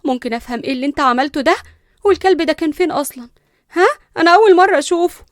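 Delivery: fast, loud, rising indignation; agitated anger. A narrator's reading. Cousin confronting him about the dog, agitated. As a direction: demand an explanation hotly. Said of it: "قربت ليندا منه بعصبية".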